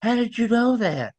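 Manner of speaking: high, amazed "how did you know"; surprised question. Retold or imitated. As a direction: act out her astonishment, voice jumping up. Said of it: "She goes".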